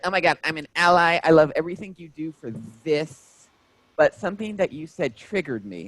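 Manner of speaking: chirpy overeager fan gushing after the show; imitating the fan. Fan gushing to the comedian after his show, mock imitation. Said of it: "in the same tone, cadence, and everything".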